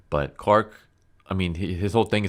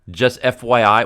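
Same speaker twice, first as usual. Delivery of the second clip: "Just F Y I" higher and louder, smug and casual; mocking tech speak; 5 semitones higher, 9 dB louder than usual. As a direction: sneer the "F Y I" like a know-it-all. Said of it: "You having him speak like this?".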